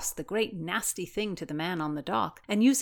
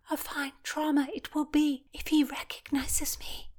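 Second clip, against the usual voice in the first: higher hushed whisper; a nervous whisper; 10 semitones higher than usual. Acted. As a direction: mutter it under your breath. Fearful aside near Blackjack.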